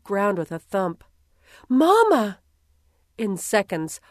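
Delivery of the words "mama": high, loud cry of alarm. Acted. Girl seeing Mama slip and fall, loud alarmed cry. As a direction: call out as she hits the ground. Daughters see mother fall.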